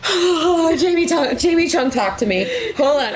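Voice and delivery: high, pleading, breathless fangirl; starstruck gush. Retold or imitated. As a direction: swoon over the actor. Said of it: "and I'm like".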